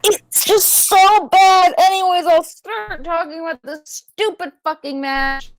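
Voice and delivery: real fed-up outburst at a high pitch; very mad. Real, not acted. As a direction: drag out the outburst, then grudgingly carry on. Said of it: "I'm very mad right now".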